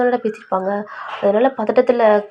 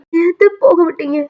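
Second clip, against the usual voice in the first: higher-pitched, mildly distraught question; upset reproach; 7 semitones higher, 4 dB louder than usual. Acted. Grandson asking aunt why she let grandmother go, upset reproach. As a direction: reproach her, lightly acting upset.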